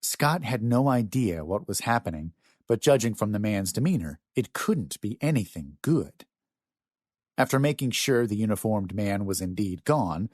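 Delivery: usual speaking voice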